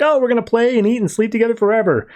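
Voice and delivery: put-on high, rushed childlike glee; retold excited kid. Cindy gets Harley back, excited and gushing. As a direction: imitate an eager little girl. Girl reunited with her toy.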